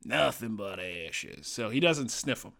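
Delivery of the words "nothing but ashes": lower-pitched working-class British orc, drawn out; low-class British accent. Retold or imitated. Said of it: "perceived low-class British accent".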